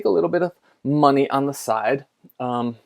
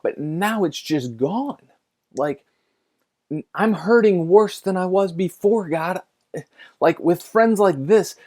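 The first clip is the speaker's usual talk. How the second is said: higher and louder, building frustration; exasperated complaint to God; 4 semitones higher, 4 dB louder than usual. Retold or imitated. Preacher retelling his outburst at God, exasperated. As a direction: vent at God like he let you down. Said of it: "I'm starting to question God".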